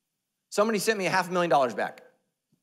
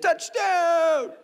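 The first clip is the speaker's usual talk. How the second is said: high laughing touchdown call; mock cheer; 9 semitones higher than usual. Church celebrating someone saved, laughing cheer. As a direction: cheer it like a stadium fan.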